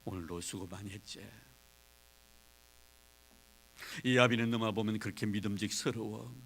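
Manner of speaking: soft, gentle fatherly coaxing; hushed and kind; calm father. Acted. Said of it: "이성을 차리고 아들에게 말합니다".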